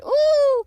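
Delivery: long, high, loud hooting "ooh"; rising-in-the-air ooh. A narrator's reading. Piglet going up in the air, high drawn-out ooh. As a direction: hold a high wail of "ooh". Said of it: "as he went up in the air".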